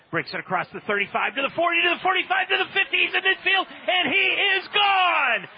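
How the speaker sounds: soaring, rising excited touchdown call. Real, not acted. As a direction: build to a roaring breakaway call.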